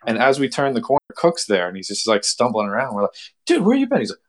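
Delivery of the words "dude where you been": high-pitched incredulous question; acted-out squeal at a missing buddy; mock exasperation. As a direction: imitate frantic friends, voice way up. Friends demand where buddy was.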